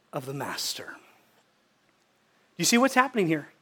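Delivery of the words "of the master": whispered. Acted. Preacher voicing the master's welcome, whispered close. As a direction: drop to a breathy whisper.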